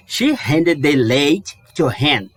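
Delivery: slow, careful English read; slow model sentence. Shown as a demonstration. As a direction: read it out slowly for learners. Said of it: "próxima frase é".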